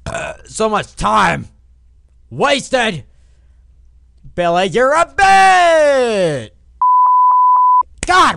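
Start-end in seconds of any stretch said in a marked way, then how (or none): 4.4-8.4 high, loud, stretched-out shout; angry drawn-out yell. Real, not acted. Player blaming the kid on his bike, long yell. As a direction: yell it slowly, holding the last word.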